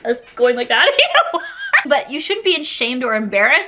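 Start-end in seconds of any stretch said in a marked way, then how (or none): 0.9-1.8 giggling at the worm buddies joke; high laugh. Real, not acted. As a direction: burst of high-pitched laughter.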